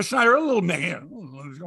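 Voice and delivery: ordinary delivery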